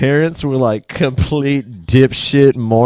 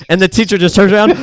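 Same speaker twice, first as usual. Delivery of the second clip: ordinary talk, nothing marked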